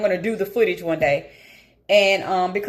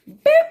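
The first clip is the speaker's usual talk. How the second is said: sharp high squeaky beep, far louder; a quick high bleep to cover a swear; mock censor beep; 9 dB louder than usual.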